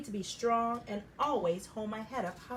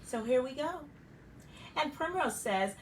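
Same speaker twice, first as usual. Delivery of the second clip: plain reading voice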